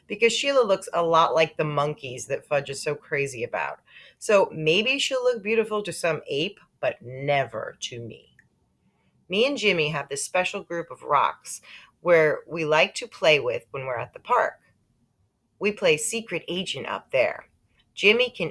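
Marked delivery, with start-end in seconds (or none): none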